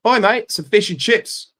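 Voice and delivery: loud, high-pitched hammed-up British shout; mock British accent. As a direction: call out an order as a caricature Brit. Mock British fish and chips order.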